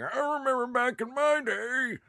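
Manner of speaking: put-on high, creaky grumpy old man. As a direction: whine it like a cranky grandpa. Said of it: "I sound like an old man now".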